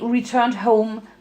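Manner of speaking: ordinary delivery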